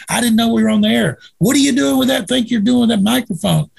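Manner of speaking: high-pitched, exasperated back-and-forth; retold argument. Guest and radio host argue over the microphone, mock-exasperated. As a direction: act out a squabble, pitched well up.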